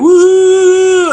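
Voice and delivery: high, loud whoop from the back seat; whooping as the car tears off; excited whoop. Retold or imitated. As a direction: let out a wild joyful woo. Friends cheering in speeding car.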